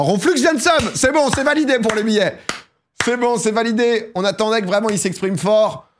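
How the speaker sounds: high-pitched, full-voiced cheer; excited announcing. Real, not acted. Streamer validating the winner's tickets, excited high announcing. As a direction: proclaim it high, like a winner confirmed.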